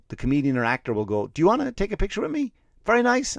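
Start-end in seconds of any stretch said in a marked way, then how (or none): 1.3-3.4 much higher, gracious celebrity offer; mock celebrity voice. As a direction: play a smug star being generous. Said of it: "the celebrity will go".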